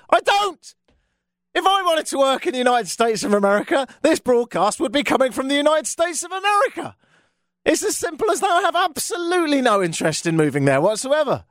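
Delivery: high-pitched, incredulous rant; worked-up, high-pitched denial; exasperated protest. Real, not acted. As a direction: insist indignantly, pitch riding high. Ranting answer to border questioning.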